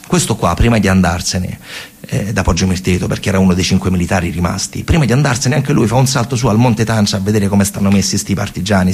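usual speaking voice

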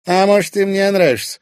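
high, loud teasing question; snorting scorn. A narrator's reading. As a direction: tease him with open scorn. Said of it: "фыркнула она".

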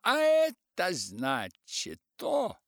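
high, loud, slow mocking answer; jolly police chief. A narrator's reading. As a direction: tease with a smug rise.

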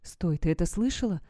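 usual speaking voice

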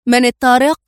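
mild frightened shout; panicked call. A narrator's reading. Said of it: "فهتف في ذعر".

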